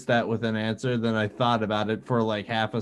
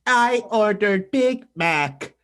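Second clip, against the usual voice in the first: robot voice; 7 semitones higher, 6 dB louder, much slower than usual. Pretend. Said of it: "could you imagine this".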